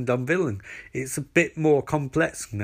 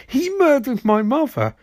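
very high, hammed-up insistence; mock anguished confirmation; 10 semitones higher, 3 dB louder than usual. Barry confirms the murder to Iris, mock anguish. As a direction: wail it back as a confirmation.

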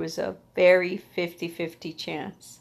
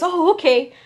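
higher, louder startled "oh, okay"; mock surprised doctor; 10 semitones higher, 7 dB louder than usual. Doctor surprised the patient wants treatment tonight, startled okay. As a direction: play the doctor caught off guard.

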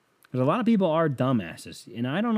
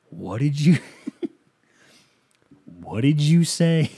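slow, drawn-out disbelief; mock-stunned question through a chuckle; incredulous host; 3 semitones higher, 4 dB louder, slower than usual. Radio host questioning his caller, mock incredulous. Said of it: "he just goes".

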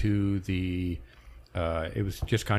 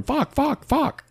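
high, a bit louder mock Maine "Fuck" for fork; Maine accent; 4 dB louder, much slower than usual. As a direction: copy the Maine guy's "Fuck" around the house, pitched high. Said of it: "just going".

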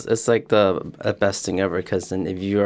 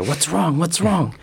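higher, worried mother asking; concerned mom voice; 7 semitones higher, slower than usual. Retold or imitated. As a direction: voice the anxious mom checking in. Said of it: "My mom went up to her".

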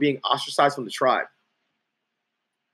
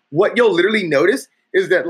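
usual speaking voice

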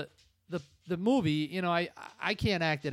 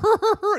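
laugh; 10 dB louder than usual. Real, not acted. Laugh after the Skank voice.

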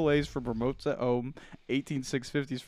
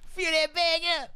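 high yell. Pretend. Chanted yelling over interruption.

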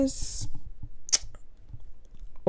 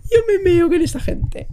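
laughing hard; 12 semitones higher, 6 dB louder than usual. Real, not acted.

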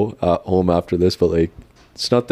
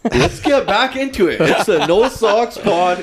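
podcast intro voice; 10 semitones higher than usual. Acted. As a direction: hype up the show opening. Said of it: "Oh, that was intro".

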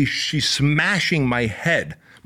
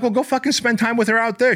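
high-pitched retold comeback; exasperated retort; 13 semitones higher than usual. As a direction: fire back, exasperated, pitch way up. Snaps back at angry girlfriend.

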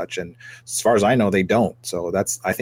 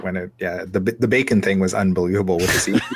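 ordinary talk

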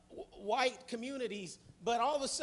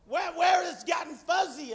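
loud, higher pulpit cry; preaching shout; 7 semitones higher, 10 dB louder than usual. Acted. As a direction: call it out to the church. Preaching peak to congregation.

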